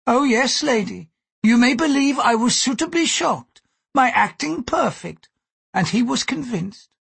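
put-on high-pitched, emphatic older-world courtesan voice; indignant Gyoko. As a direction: protest vehemently in a high character voice.

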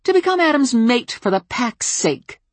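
high, loud sneering snap; angry sarcasm. Acted. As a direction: finish the jab with contempt. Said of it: "she snapped".

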